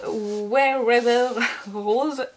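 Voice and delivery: a slow, self-conscious English accent on the product name. Real, not acted. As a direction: say Wherever Rose with a proud, slightly hammed English accent. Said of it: "Appréciez mon accent". (prononcer Wherever Rose avec un accent anglais appuyé)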